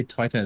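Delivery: Taito pronounced TIE-toe; TIE-toe pronunciation. Shown as a demonstration. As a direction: give Taito the vowel of "tie" in its first syllable. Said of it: "some people like to say".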